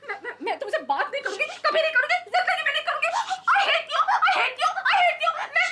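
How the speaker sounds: high, loud wailing rant; hysterical tantrum. Acted. Betrayed woman rejects her lover, hysterical. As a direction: work up into a shrieked outburst.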